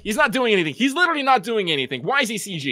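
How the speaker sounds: high-pitched, incredulous protest; exasperated rant. Acted. Fan ranting about a needless CG model, exasperated. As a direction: pitch jumps up in baffled outrage.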